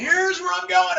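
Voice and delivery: high and loud, bursting with excitement; excited. Shown as a demonstration. Pitching his vision to a prospect, loud and excited. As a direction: sell the vision with real thrill. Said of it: "And I get all excited".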